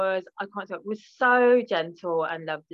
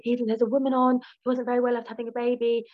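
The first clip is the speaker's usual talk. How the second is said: quick, higher motherly voice, lightly put on; a light take on her mum on the phone; imitating mum; 4 semitones higher, faster than usual. Daughter imitating her mum phoning about TV, lightly higher and quick. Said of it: "she'll phone me and go".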